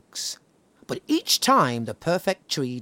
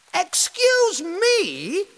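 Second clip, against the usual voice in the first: much higher, slow, louder indignant voice; indignant tree; 6 dB louder, much slower than usual. Acted. The tree scolding the old woman, indignant. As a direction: drawl it out offended. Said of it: "said the tree".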